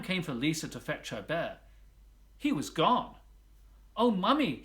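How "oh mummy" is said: high, loud worried cry; a little girl calls out to her mother in alarm; child's exclamation. A narrator's reading. Girl tells mother her bear is missing, high loud exclaiming. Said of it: "she exclaimed".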